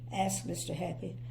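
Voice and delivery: plain reading voice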